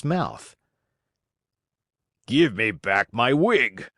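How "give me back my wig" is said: slow, surly demand; surly shout. A narrator's reading. Carpenter demands his wig from Geppetto, surly and slow. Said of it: "shouted Mastro Antonio in a surly voice".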